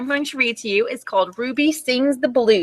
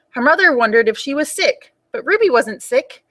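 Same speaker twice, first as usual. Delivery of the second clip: ordinary delivery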